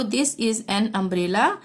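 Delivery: slow, even repeat of the sentence; slow dictation. Shown as a demonstration. Teacher repeating the sentence for pupils, slow and clear. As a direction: spell it out slowly for learners.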